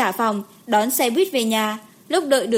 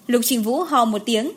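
usual speaking voice

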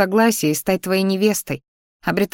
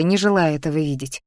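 plain reading voice

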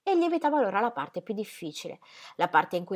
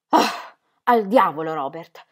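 louder, slow, forceful curse; violent exclamation; 2 semitones lower, 8 dB louder, slower than usual. Acted. Ingram cursing Robert in front of Ingeborg, violent. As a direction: spit it out with violence. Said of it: "esclamò lui con violenza".

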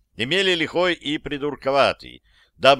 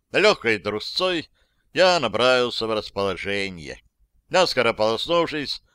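ordinary delivery, nothing marked

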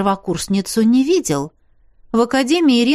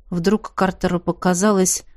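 ordinary delivery, nothing marked

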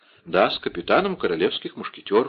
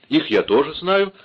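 higher-pitched startled outburst; raised, anxious exclamation; alarmed exclamation; 6 semitones higher, 3 dB louder than usual. A narrator's reading. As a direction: blurt it out in alarm. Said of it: "воскликнула миледи, чувствуя, что вся холодеет от страха".

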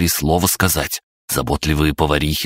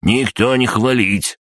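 stern cutoff; 7 semitones higher, slower than usual. A narrator's reading. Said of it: "сурово отрезал крабогном".

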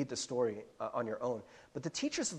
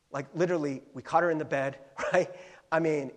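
ordinary talk, nothing marked

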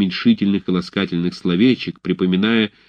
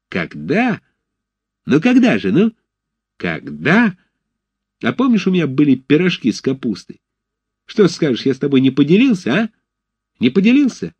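taunting; 7 semitones higher than usual. A narrator's reading. Fedchenko reproaches Arap heatedly.

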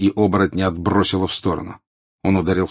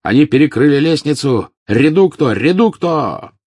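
higher, much louder battle cry; shouted spell; 8 semitones higher, 8 dB louder than usual. A narrator's reading. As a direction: yell the spell over the fight. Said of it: "чей-то голос прокричал". (крик заклинания, громко и высоко)

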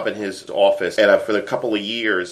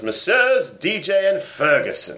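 reading a listener's letter in a hammed-up British accent; 3 semitones higher than usual. Podcast host reads British listener's letter aloud, mock British accent. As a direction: put on a jolly English accent, pitched up. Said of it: "try saying the word fellas in my accent".

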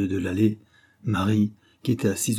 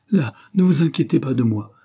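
louder, higher-pitched cry; raised voice, pitched up; 5 semitones higher, 6 dB louder than usual. A narrator's reading. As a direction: call out urgently to wave off concern. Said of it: "cria-t-elle".

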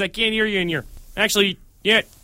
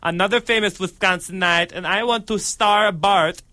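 Fez. Acted. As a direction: draw it out in a put-on foreign voice. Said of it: "it's Fez here".

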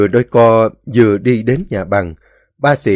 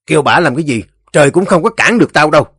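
raised, fast brush-off; angry defiance; 6 semitones higher, 5 dB louder, much faster than usual. A narrator's reading. Ba Xị shakes off Tùng and refuses restraint, angry. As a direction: snap back louder and higher.

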